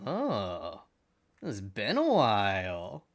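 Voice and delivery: slow, drawn-out talking sword; sword spirit. Acted. Voice in the player's head after attunement, slow sword spirit. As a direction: stretch it out like a spirit waking in someone's head. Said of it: "you hear a voice in your head, and he goes".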